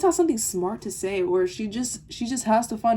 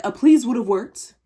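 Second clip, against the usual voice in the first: loud pointed sass on please; sassy retort; 8 dB louder than usual. Retold or imitated. Retelling the comeback to the rude classmate, loud and sassy. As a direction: snap it back at her with attitude. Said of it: "so I was like".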